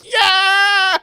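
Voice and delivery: loud, high-pitched whoop; retold cheer. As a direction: yell it like a gleeful reenactment. Retells own cheering reaction.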